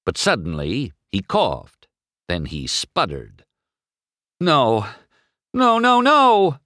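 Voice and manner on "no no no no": high, loud, drawn-out wail; dismayed cry. A narrator's reading. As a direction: cry out as it slips away. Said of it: "McQueen cried as he slowed".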